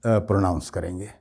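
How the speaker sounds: ordinary delivery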